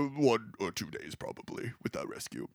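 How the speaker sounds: low, soft, grave statesman; quiet wartime-leader impression; Churchill. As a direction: answer in a deep, hushed orator's voice. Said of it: "obviously I'm doing the voice for Churchill".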